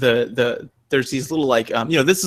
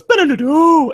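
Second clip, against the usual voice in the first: high loud sung jingle; game jingle imitation; 6 dB louder, slower than usual. Imitating the symbol-change jingle, high and loud. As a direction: chirp the power-up sound.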